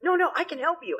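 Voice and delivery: high, pushy helpful voice; eager doctor parody. Retold or imitated. Doctor pushing her services on the sick, high and eager. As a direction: chase the patient in a high eager voice. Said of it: "anytime somebody doesn't feel good".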